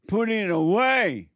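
high, loud, slow command; commanding shout. Real, not acted. Preacher ordering congregation to drop anger, slow loud command. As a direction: bark it slowly like an order.

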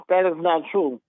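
usual speaking voice

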